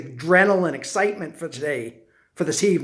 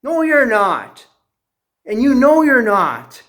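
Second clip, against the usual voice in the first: insistent, higher-pitched denial; firm contradiction; 4 semitones higher, 5 dB louder than usual. Retold or imitated. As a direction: contradict him firmly, pitched up.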